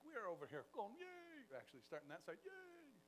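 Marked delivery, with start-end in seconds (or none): 0.0-1.5 a high squeaky playful yay; mock cheer. Telling the crowd about the midnight sun, high playful cheer. 2.4-3.0 a high squeaky playful yay; mock cheer. Telling the crowd about the midnight sun, high playful cheer.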